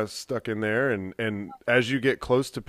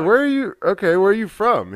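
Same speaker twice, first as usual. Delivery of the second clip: pitched up, louder, pressing where from; high surprised question; 9 semitones higher, 7 dB louder than usual. Retold or imitated. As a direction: blurt it in surprise. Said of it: "I was like".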